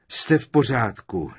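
ordinary delivery

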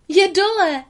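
loud, high shout of relief; relieved cry. Acted. Lady cries out in relief as the muzzle comes off. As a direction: cry out, glad it's finally off. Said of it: "vykřikla s ulehčením Lady".

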